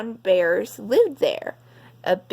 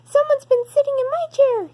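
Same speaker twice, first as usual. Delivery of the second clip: put-on very high, louder, fast baby bear cry; cried; 5 dB louder, faster than usual. Baby Bear finds his chair, very high fast upset cry. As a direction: wail it out like an upset little cub. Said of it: "he cried".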